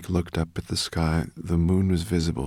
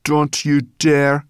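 loud, high, slow threat; bossy creature laying down the law; menacing; 9 semitones higher, 11 dB louder, slower than usual. A narrator's reading. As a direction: punch each word as a warning. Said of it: "she added menacingly".

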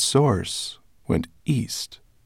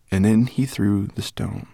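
plain reading voice

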